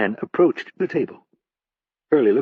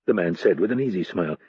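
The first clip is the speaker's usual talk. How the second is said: ordinary delivery, nothing marked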